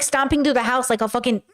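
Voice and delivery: usual speaking voice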